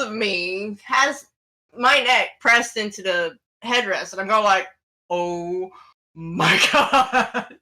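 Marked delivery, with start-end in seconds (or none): 5.1-7.6 low "oh my God" breaking into laughter; laughing disbelief. Retold or imitated. Driver retelling being grabbed, laughing disbelief. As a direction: let a laugh split the exclamation.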